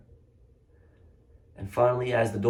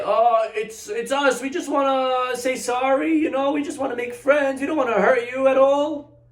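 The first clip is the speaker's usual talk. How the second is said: much higher, sugary mock-innocent voice; fake sweet apology; 12 semitones higher than usual. Bullies tricking the boy with a syrupy apology. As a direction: lure him out with false sweetness.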